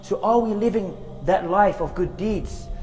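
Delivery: usual speaking voice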